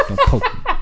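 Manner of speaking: sudden squeaky high "Don't poke me"; a jokey squeak of protest; squeaky yelp. Acted. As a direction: squeal it in mock outrage. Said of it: "my Grammar Media rant".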